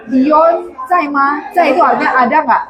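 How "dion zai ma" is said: slow and careful over the phone; slow phone question. Shown as a demonstration. Caller asking for Dion on the phone, slow. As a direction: spell out each syllable slowly. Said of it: "terus dia bilang".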